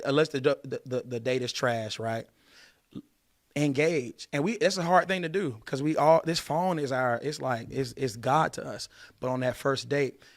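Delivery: ordinary talk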